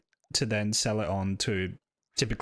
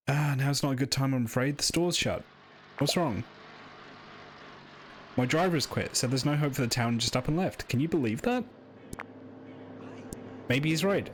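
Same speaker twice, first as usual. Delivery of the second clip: lightly voiced weary shopkeeper, a bit higher; a hint of a glum small-town shopkeeper; 4 semitones higher than usual. Shopkeeper telling the player the town is dying, lightly voiced. Voicing shopkeeper NPC dialogue.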